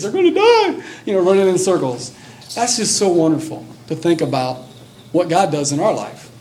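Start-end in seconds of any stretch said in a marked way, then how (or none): 0.0-0.8 very high mock wail; hammed-up squealing cry of panic; crying like babies. Picturing strong men running around, mock baby crying. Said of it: "crying like little babies".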